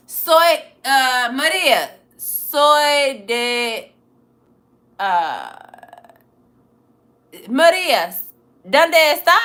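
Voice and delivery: calling out loud in halting Spanish; loud beginner Spanish, asked of a viewer named Maria. Real, not acted.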